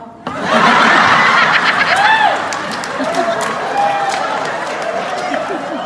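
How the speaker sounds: shrieking laugh. Real, not acted.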